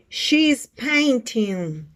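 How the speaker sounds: very slow, drawn-out words; model sentence for repeating. Shown as a demonstration. Teacher reads a picture's sentence, slow model delivery. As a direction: stretch it out for learners to copy. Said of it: "Repeat after me".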